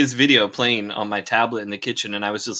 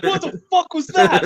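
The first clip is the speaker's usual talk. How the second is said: very high retold startled yelp. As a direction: act out a jump scare, half laughing. Acts out being startled in kitchen.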